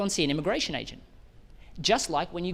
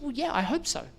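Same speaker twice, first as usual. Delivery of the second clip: Wendy, modest; 7 semitones higher than usual. Retold or imitated. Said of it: "She said".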